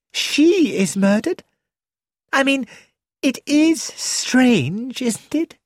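put-on high, wondering young woman, slowing on it is strange; linger, high and a little spooked; Rosamond. A narrator's reading. Rosamond muses on the murders.

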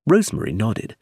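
ordinary delivery, nothing marked

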